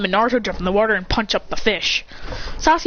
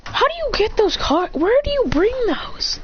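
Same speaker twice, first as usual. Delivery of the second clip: baffled high voice; 13 semitones higher than usual. Acted. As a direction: squeak it out, baffled. Puzzled about the Uno cards.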